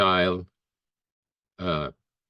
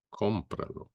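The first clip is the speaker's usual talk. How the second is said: Cómpralo repeated much softer; 4 semitones higher, 8 dB quieter than usual. Shown as a demonstration. Teacher to students, soft echo of the word. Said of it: "we say in Spanish".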